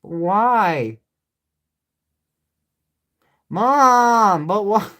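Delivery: put-on loud whiny child calling mom; bratty kid pestering mom.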